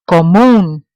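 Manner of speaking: Común, punching MÚN; Común with a loud, long last syllable; final-syllable stress. Shown as a demonstration. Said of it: "stress on the end of the word".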